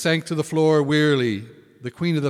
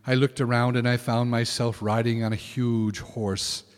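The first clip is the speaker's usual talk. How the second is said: ordinary delivery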